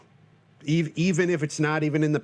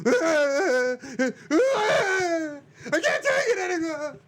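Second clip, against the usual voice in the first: his own freak-out; 13 semitones higher, slower than usual. Shown as a demonstration. Host shows listeners how he freaks out, a high wail. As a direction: whine it like you've hit your limit, half laughing. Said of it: "that's my impression of me".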